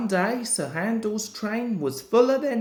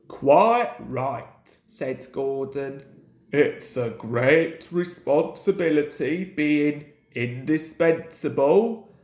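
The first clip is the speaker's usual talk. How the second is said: pompous big engine; 5 semitones lower than usual. Acted. Gordon's grand engine voice.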